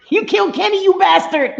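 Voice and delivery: high, loud mock outrage; South Park catchphrase. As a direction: cry it out like an indignant cartoon kid.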